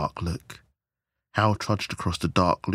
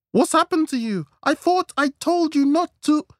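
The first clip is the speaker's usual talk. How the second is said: very high, loud, outraged wife voice; angry scolding; 6 dB louder than usual. Acted. Wife confronts her battered husband, furious. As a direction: scold him at a high-pitched yell.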